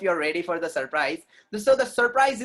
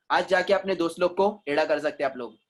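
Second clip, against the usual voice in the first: ordinary talk, nothing marked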